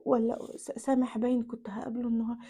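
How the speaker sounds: unvoiced whisper to herself; whispered aside. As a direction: whisper it behind the phone. تهمس لنفسها أثناء المكالمة. (همس جانبي)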